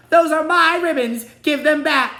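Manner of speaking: very high, very loud shriek. A narrator's reading. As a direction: shriek at the thief in rage. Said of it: "she shrieked".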